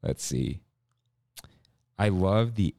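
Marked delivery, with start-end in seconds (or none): none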